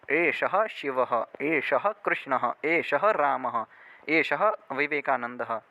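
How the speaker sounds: slow, clear model phrases for repeating; slow drill. Shown as a demonstration. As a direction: say each phrase slowly to be copied. (धीमे, साफ़ अभ्यास वाक्य)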